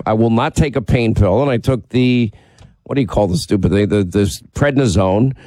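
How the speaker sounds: ordinary talk, nothing marked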